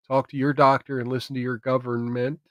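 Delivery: ordinary delivery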